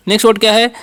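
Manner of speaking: usual speaking voice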